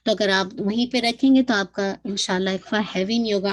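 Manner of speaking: usual speaking voice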